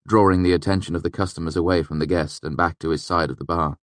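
ordinary delivery

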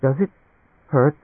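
hesitant, mildly nervous question; a touch of timidity, pausing mid-question. A narrator's reading. Emily asks the doctor about therapy, mildly nervous. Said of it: "Emily said nervously".

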